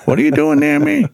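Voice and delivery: high mock-whiny voice pushing them off; whiny grump. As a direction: whine like a sulky child. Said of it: "they're like".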